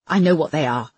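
ordinary delivery, nothing marked

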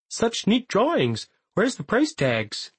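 put-on high, slow, broad Canadian accent; thick Canadian accent. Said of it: "applying the thickest Canadian accent".

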